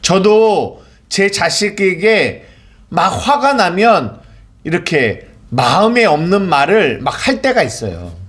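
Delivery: ordinary talk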